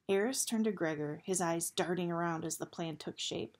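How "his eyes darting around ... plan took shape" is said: ordinary delivery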